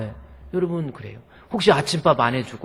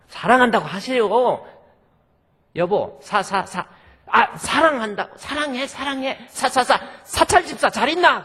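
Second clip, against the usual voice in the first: much higher, loud stuttering attempt that swerves away; stammering husband; 11 semitones higher, 6 dB louder than usual. Gyeongsang pastor on the phone to his wife, failing to say I love you, stammering. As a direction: stammer sa-sa-sa and bail out. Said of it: "도저히 사랑한다는 말을 못하고".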